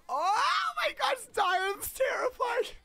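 very high, loud, drawn-out squeal; over-the-top scared screaming; terrified shriek. Pretend. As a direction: shriek in hammed-up horror, stretching the words. Terrified reaction to Diane.

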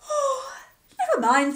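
high, loud, motherly voice; Missus Bear. Acted. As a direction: comfort him like a fussing mother bear. Missus Bear comforting husband.

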